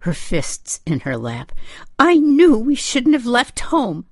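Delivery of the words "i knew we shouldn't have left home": high, loud, upset wail; distraught mother, voice pitched way up; wavering voice. A narrator's reading. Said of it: "in a wavering voice".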